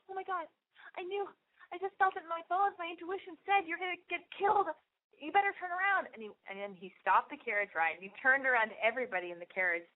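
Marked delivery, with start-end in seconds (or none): 0.1-6.1 high, squeaky fretting voice; mock panic. Tourist confessing her bad intuition to the driver, mock panic. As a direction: imitate a frightened squeak, pitched way up.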